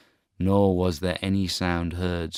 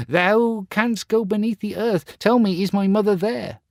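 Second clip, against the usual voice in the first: very high, loud, eager plea; pleading boy; 14 semitones higher, 5 dB louder than usual. Acted. Star Child asking the mole about his mother, very high. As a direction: ask the mole urgently.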